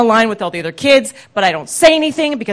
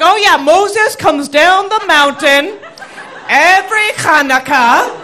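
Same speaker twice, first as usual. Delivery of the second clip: higher, slow, exaggerated storytelling as a mock mother; mother improvising; 7 semitones higher, slower than usual. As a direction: sell a made-up tale, stretching it out.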